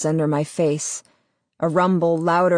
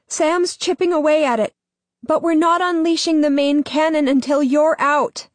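urgent woman's voice; 10 semitones higher, 3 dB louder than usual. Acted. Ella speaks into his mind.

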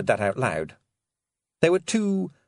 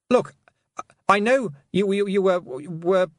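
put-on high, stammering boyish voice; flustered Roland; 10 semitones higher, 4 dB louder, slower than usual. A narrator's reading. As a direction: stumble over the words, embarrassed. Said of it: "Roland began, going red".

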